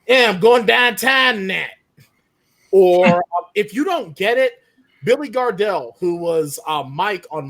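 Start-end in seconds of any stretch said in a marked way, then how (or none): 0.1-1.7 loud, high Yinzer accent; Pittsburgh accent. Shown as a demonstration. Yinzer author showing the panel how Pittsburgh says it, broad Pittsburgh accent. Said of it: "In Pittsburgh, we say".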